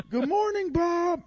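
very high, loud parrot squawk; parrot greeting. Acted. Parrot waking [name] every morning, high squawky greeting. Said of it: "he'd wake up every morning".

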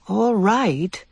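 hesitant, unsure yes; agreeing without believing it; doubtful agreement. Acted. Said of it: "she said doubtfully".

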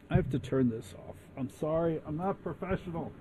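mumbling an apology away from the mic; off-mic aside. Real, not acted.

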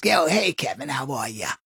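smoker lady voice. Shown as a demonstration. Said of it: "if a lady talks like this".

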